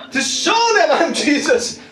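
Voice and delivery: high, drawn-out triumphant cry; playful boast. Pretend. Preacher joking about showing mockers his power, playful boast. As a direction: boast it slowly with pitch way up. Jokey show of power as Jesus.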